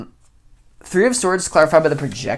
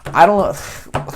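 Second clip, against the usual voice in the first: goofy, higher and louder; silly voice; 4 semitones higher, 4 dB louder, slower than usual. Pretend. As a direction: play it silly and bright-eyed.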